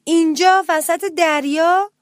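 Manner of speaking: high, loud, slow disbelief; astonished question. A narrator's reading. Little mouse to captain, asking in astonishment. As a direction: draw it out in amazement. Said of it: "با تعجب پرسید".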